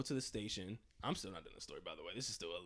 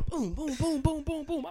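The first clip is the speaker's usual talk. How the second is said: punch sounds; 12 semitones higher, 5 dB louder, slower than usual. Retold or imitated. Imitating mom's punches.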